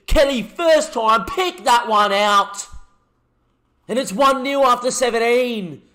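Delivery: loud, high thrilled commentary, slowed on big words; elated commentary. Real, not acted. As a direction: ride the goal high. Excited goal aftermath commentary.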